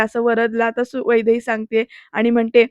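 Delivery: usual speaking voice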